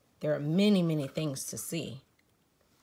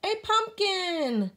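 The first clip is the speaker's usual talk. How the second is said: much higher, slow, delighted; happily; 5 dB louder, slower than usual. A narrator's reading. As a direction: savour the word with joy. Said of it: "Spook says happily".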